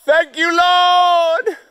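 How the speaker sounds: high, loud cry of thanks; joyful shout. Real, not acted. Relieved hiker shouting thanks to God, loud joy.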